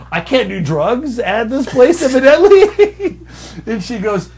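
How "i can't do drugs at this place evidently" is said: high, loud, drawn-out protest; mock incredulous complaint. Applicant telling recruiter about drugs, mock outraged squeal. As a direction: stretch it out, climbing to a squeal. Acted phone reply to recruiter.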